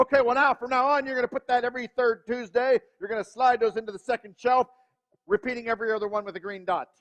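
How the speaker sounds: dad laying down rules. Retold or imitated. Dad reciting household rules to the kids, loud and brisk. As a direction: rattle off rules like a manual.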